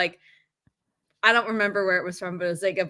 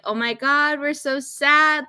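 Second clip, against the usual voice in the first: mock sad commenters; 6 semitones higher, 4 dB louder, slower than usual. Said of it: "everyone's commenting and being like".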